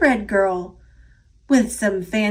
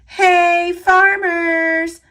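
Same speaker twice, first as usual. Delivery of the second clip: loud, higher call across the field; 6 semitones higher, 5 dB louder, much slower than usual. A narrator's reading. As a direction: hail the farmers from afar. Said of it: "She laughed and called".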